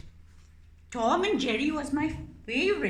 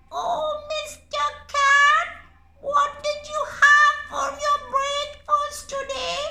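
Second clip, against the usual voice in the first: much higher, loud singsong question; singsong squeaky mouse; 8 dB louder than usual. Acted. Mouse puppet sweetly stalling the cat, singsong squeak.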